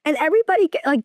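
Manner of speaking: ordinary delivery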